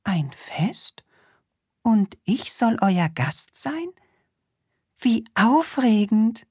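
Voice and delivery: rising, thrilled high voice; excited princess. Acted. The princess is invited to a party, rising excitement.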